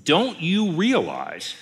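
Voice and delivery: pointed, incredulous challenge; exasperated rebuke. Acted. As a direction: press it slowly, as if they should know better. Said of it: "this is kind of his, you idiots".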